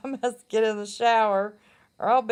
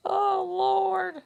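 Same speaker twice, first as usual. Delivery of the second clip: laughing; 8 semitones higher than usual. Real, not acted. Still laughing at herself.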